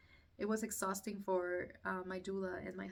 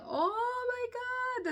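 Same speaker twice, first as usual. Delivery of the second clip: high, loud, drawn-out mock scream; screaming in pain; 6 dB louder, much slower than usual. Mother re-enacting the next contraction, screamed high and long. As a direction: wail it out slowly, acting out agony. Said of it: "screaming my lungs out".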